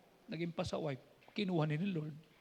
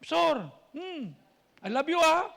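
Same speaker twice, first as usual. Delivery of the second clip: affectionate cry; 7 semitones higher, 10 dB louder than usual. Retold or imitated. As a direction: gush over the pastor. Friend sweetly calls pastor.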